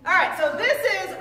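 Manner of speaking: laughing it off. Real, not acted. Teacher caught out, laughs it off.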